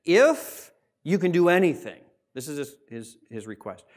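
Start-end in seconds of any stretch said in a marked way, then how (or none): 0.0-2.0 raised, louder plea leaning on "If"; mildly acted pleading, "If" held out; imploring. Father implores Christ for his son, imploring. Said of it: "he implores Christ".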